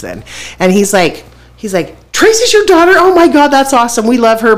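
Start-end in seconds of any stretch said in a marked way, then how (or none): 2.1-4.6 much higher, louder gushing imitation; excited guard. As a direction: gush like a starstruck guard. Guard recognizes Tracy's father.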